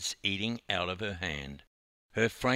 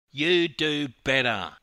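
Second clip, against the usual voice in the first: raised, challenging comeback; retort; 4 semitones higher, 6 dB louder than usual. A narrator's reading. Dawn challenging the youth to sing, retorting. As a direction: throw it straight back, louder. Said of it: "retorted Dawn".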